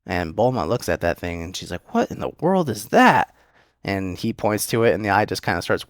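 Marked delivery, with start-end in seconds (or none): 1.9-3.4 higher, startled cry of 'What in the world'; freaked-out surprise. Retold or imitated. Bulma recoils at the eyeball on the belt, startled higher cry. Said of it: "she's just freaking out".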